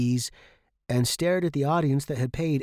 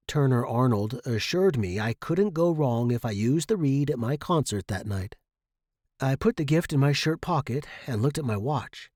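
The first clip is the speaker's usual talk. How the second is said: plain reading voice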